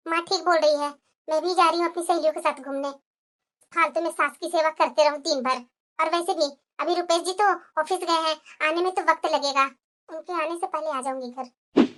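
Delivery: low, soft private aside; scheming to herself. Acted. Wife deciding to slip out with friends, scheming quietly.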